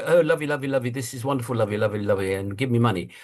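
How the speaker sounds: lightly voiced gushing ad exec; mock ad man. Advertising people fawning for money, lightly mocked. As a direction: purr it like a smarmy salesman.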